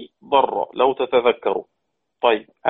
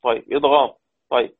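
ordinary talk

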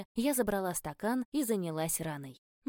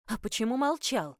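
higher, louder scolding growl; 5 semitones higher, 4 dB louder than usual. A narrator's reading. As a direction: snap at him angrily. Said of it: "рыкнула я".

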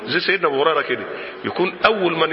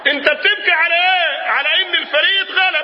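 mock outcry; 12 semitones higher, 3 dB louder than usual. Preacher challenges fans crying over a lost match, mock outcry. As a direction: cry it out high, as a mock lament.

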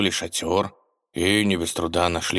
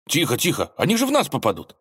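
higher, fast alarmed cry; 9 semitones higher, faster than usual. Acted. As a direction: blurt it out in alarm. Ivan alarmed by shooting idea.

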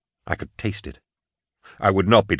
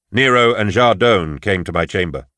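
plain reading voice

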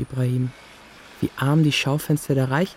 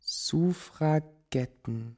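slow reading aloud; 2 semitones higher, much slower than usual. Acted. Boy alone with dictionary, slowly reading headwords aloud. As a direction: sound out each syllable slowly.

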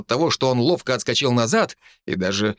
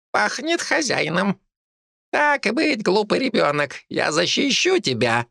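squeaky rat voice; 10 semitones higher than usual. A narrator's reading. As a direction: squeak like a proud little rat. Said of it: "пропищала крыса".

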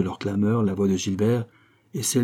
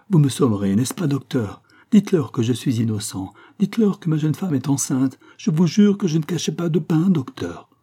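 pleading; 6 semitones higher than usual. A narrator's reading. Innocent man begs for life.

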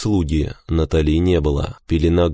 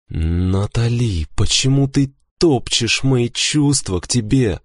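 gather your nerve and plead, voice rising; pleading question; 6 semitones higher than usual. A narrator's reading. Husband asking why she tramples his feelings, pleading. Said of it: "найти силы в себе и всё-таки задать вопрос".

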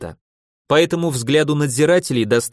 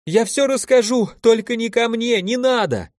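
high, pleading cry of a terrified prisoner; desperate cry; 9 semitones higher than usual. A narrator's reading. Prisoner begs the guards not to enter his cell, desperate cry. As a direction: beg them not to come in. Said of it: "кто-то отчаянно закричал".

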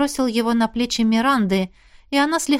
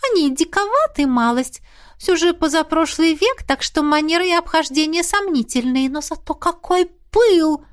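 put-on high ghost girl voice; 7 semitones higher than usual. A narrator's reading.

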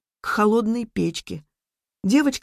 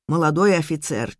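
usual speaking voice

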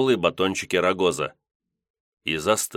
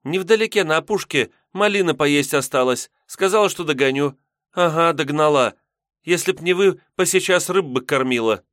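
put-on high, annoyed young girl; vexed girl voice; 9 semitones higher, 4 dB louder than usual. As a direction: grumble like a girl left behind. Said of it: "Забава с досадой махнула рукой".

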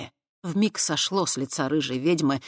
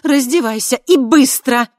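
high, loud, snapped order; curt command; 9 semitones higher, 11 dB louder than usual. A narrator's reading. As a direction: bark the order at her. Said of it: "бросила она".

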